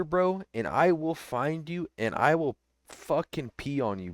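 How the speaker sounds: ordinary delivery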